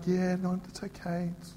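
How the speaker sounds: softly fading appeasement; meek peacekeeper. Acted. Peacekeeper placating a complainer, meek and quiet. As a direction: give in quietly, trailing down to almost nothing. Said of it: "the peacekeeper's like".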